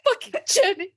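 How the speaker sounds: laughing wail. Retold or imitated. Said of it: "And I was like".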